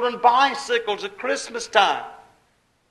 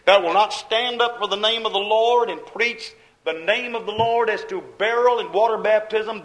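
normal talking voice

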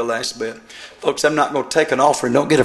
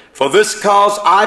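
scripture reading; 6 semitones higher, 7 dB louder, slower than usual. Acted. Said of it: "if I read, then you'll think I'm preaching".

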